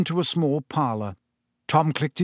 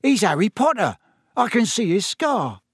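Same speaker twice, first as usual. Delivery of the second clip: 1 excited Stan; 8 semitones higher, 3 dB louder than usual. A narrator's reading. Stan excitedly tells Ern.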